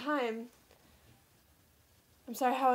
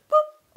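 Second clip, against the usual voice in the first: squeaky high boop; 11 dB louder than usual. Retold or imitated. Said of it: "She's like".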